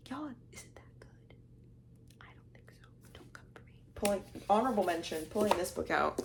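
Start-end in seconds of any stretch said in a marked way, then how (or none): 0.1-3.7 whispered sceptical aside; whisper, don't let anyone hear; hushed playful confession. Real, not acted. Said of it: "Why am I whispering?".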